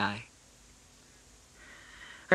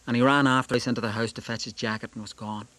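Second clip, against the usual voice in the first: plain reading voice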